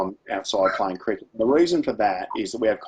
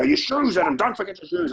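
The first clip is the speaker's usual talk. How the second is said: nagging mum; 10 semitones higher than usual. Retold or imitated. Mum calling after her son at the door, mock nagging. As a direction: do a mock sing-song mum reminding him.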